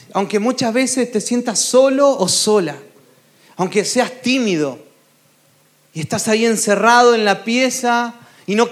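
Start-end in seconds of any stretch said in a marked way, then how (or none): none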